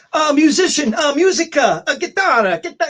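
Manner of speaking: high, loud nervous attempt at a Mexican accent; fumbling words in a fake Mexican accent to save his skin; put-on Mexican accent. Frightened musician explaining himself to gunmen, fake Mexican accent. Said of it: "trying to do a Mexican accent".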